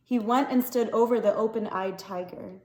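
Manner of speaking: ordinary delivery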